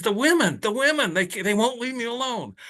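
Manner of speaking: a hammed-up high whine of a man hounded by women; exasperated wail. Patient's exasperated punchline.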